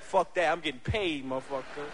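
low, fading tough guy; low street voice. Retold or imitated. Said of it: "They was like".

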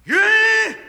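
huge high belt from the gut; belted shout. Shown as a demonstration. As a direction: sing it from the floor up. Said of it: "it has to come from the floor up".

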